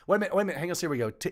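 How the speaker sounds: normal talking voice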